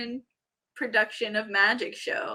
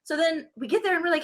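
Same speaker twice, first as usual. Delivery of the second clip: ordinary delivery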